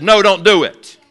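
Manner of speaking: pleading cry. Retold or imitated. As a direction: call out to stop him. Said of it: "Martha says".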